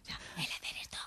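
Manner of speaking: breath-only whispered demo; hablar sin voz. Shown as a demonstration. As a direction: whisper without any timbre. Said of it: "el hablar sin voz". (susurro de puro aire)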